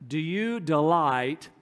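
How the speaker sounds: usual speaking voice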